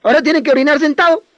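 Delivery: put-on high, loud, taunting rant at a boy; mock and humiliate, fast and high-pitched; mocking scolding. Adults shaming a little boy for crying, loud high mocking scold. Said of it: "nos dicen desde pequeños".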